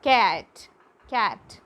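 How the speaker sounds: "cat" said loud and separately, twice; pronunciation model. Shown as a demonstration. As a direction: model "cat" for learners, punching the first one. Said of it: "to pronounce it as".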